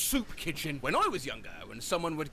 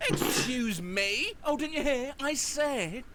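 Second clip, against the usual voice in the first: higher, drawn-out challenge; acted indignant exchange; 6 semitones higher, slower than usual. Aunt or uncle challenges niece's reply, mock indignant. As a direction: imitate an affronted back-and-forth.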